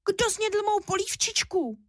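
thin, high little bear voice; small upset cub piping up; unhappy little squeak. A narrator's reading. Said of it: "Vypískl nešťastně tenkým hláskem".

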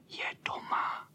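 soft, breath-only whisper from below. A narrator's reading. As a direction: whisper it cautiously, no voice. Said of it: "šeptali ti dole". (tichý šepot)

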